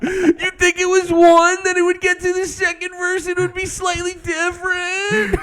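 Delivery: high, strained mock wail; mock distressed caller. Caller confessing to a hotline, in mock distress.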